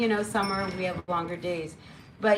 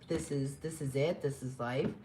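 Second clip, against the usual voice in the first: mock bored voice; 6 semitones lower, 7 dB quieter than usual. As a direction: lower and softer, flat with boredom.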